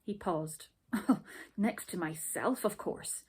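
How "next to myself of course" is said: usual speaking voice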